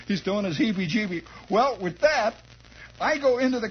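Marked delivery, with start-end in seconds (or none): none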